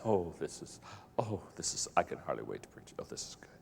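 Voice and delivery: low, soft, savoring whisper; gleeful whisper. Retold or imitated. Pastor savoring his angry sermon in a low gleeful whisper. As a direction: hush it like a secret treat. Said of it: "I'm saying".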